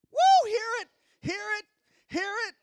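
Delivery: high falsetto call, far above own voice; whoop, then repeat it in a piping high voice; high-pitched teasing holler; mock whooping. Acting out dancing close, calling out.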